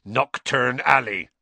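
slow, grim, heavy delivery. A narrator's reading. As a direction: say it like bad news. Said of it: "said Hagrid grimly".

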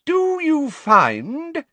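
high, very slow, sugary ring. A narrator's reading. Umbridge ringing out to Pansy, prim and sweet. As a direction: stretch it out, sweet and carrying. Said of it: "in a ringing voice".

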